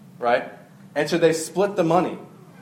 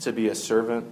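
prayer; 5 semitones lower, 4 dB quieter than usual. Acted. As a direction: pray quietly to the Lord. Closing prayer.

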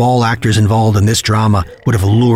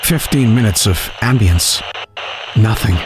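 ordinary delivery

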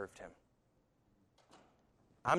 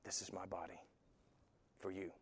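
quiet vow; 5 semitones lower, 15 dB quieter than usual. Acted.

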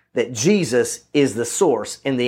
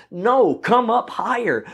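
higher, slow, drawn-out urging; constructive urging; 7 semitones higher, slower than usual. Acted. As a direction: urge them upward, stretching the words. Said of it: "he's being constructive".

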